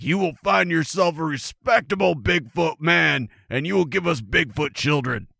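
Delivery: mock-stern family command; Bigfoot dad. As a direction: lay down the law as an outraged monster father. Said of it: "her brothers and her dad are like".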